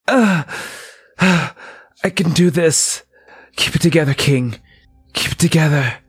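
high, strained self-pep-talk through pain; groan, then urge yourself to hold on. Acted. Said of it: "the sounds of King in pain".

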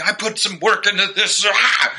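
high, whiny imitation of an upset protester; mocking protester quote. Mimicking the protester upset over her broken sign, high and whiny. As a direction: squeal it like she's put out. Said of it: "she was like".

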